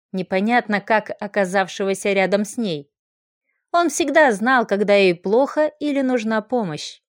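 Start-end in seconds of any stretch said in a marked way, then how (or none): none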